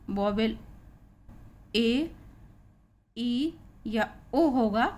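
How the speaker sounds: ordinary talk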